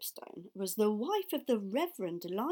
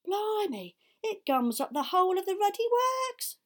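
excited exclamation; 11 semitones higher, 9 dB louder than usual. Acted. Charlie explaining the sugar trick.

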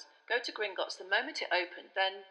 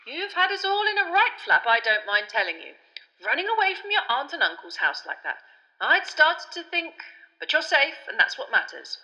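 higher, louder relieved scolding; Fudge, fussing; 8 semitones higher, 8 dB louder than usual. A narrator's reading. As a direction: scold fondly, then relieved. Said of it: "said Fudge".

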